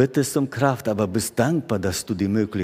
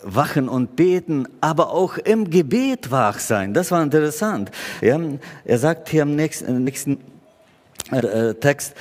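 normal talking voice

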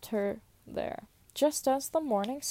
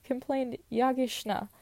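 usual speaking voice

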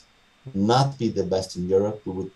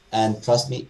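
ordinary talk

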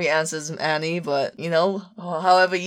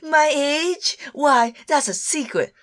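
high-pitched, coy anime lady; mock-offended anime older woman, pitched high; old anime ladies; 7 semitones higher, slower than usual. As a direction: act scandalised, voice pitched way up. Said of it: "all the old ladies in anime".

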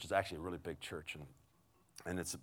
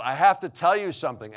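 higher, loud nervous outburst; blurting it out; 9 semitones higher, 13 dB louder than usual. Retold or imitated. Awkward lady blurting out her news to a stranger, blurted loudly. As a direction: blurt it like it can't wait. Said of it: "she blurts out".

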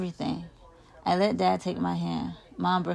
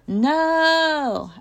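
long, high-pitched yell; high, loud cry of protest; 10 semitones higher, 8 dB louder than usual. A narrator's reading. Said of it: "I yell".

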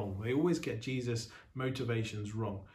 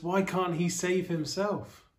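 higher, slower jeering question; mocking crowd taunt; 6 semitones higher, 3 dB louder, slower than usual. Preacher voicing the mocking crowd at the cross, jeering.

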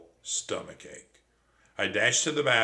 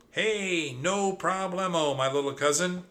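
squeaky; 9 semitones higher, 3 dB louder than usual. Acted. Trap waves a life buoy at his cousin, high squeak. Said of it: "squeaked Trap".

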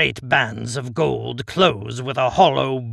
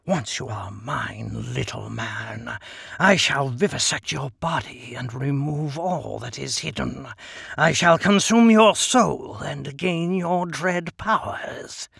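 menacing Djinn. Acted. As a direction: threaten like a hungry genie. Djinn threatens.

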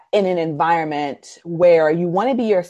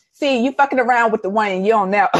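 code-switched tough talk; 4 semitones higher than usual. Retold or imitated. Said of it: "I went from talking like this to".

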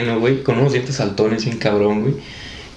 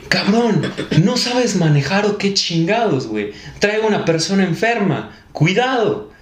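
higher-pitched scolding, moderately raised; retold angry yell; 5 semitones higher than usual. Son yelling at taxi driver with sick mother, retold anger. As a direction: tell him off, stretching the insults. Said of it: "Y le grité".

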